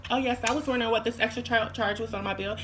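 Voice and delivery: soft, feminine business-call voice; politely put-on feminine phone manner; sisters' phone voice. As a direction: imitate your sister calling about a bill. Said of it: "'Cause that's how my sisters sound".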